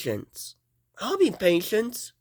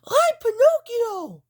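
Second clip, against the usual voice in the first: I'm Pinocchio! jumped way up high and louder; squeaky high announce; 4 dB louder than usual. Pretend. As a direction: shout the name up in a tiny toy voice. Toy play, repeated character line.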